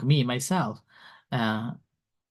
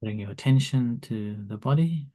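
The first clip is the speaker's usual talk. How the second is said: soft, calm meditation guide; calm grounding exercise; 2 semitones lower, 3 dB quieter than usual. Acted. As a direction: guide them gently into the body. Leads meditation.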